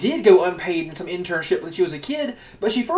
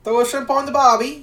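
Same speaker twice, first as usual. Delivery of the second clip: a hammed-up Australian accent, pitched up; mock Australian accent; 6 semitones higher than usual. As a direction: toss off an Australian cliche in a put-on Aussie voice. Aussie cliche put on.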